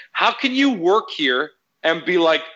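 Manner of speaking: high-pitched mock outrage, reliving the argument; retold angry rant. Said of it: "I was having that meltdown".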